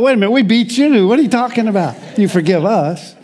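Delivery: high, fast outraged retort in British-beating pride; indignant disbelief. Retold or imitated. Preacher retorting to a British guest, high and fast in disbelief. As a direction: fire it back like you can't believe your ears. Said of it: "I thought".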